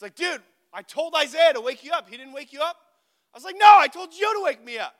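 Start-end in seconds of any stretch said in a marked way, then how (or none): 0.1-2.8 high, raised-voice acted excuse; defensive protest. Friend defending himself after a missed wake-up, loudly defensive. 3.6-4.9 very loud, high acted retort; indignant retort. Student blaming friend for not waking him, indignant shout.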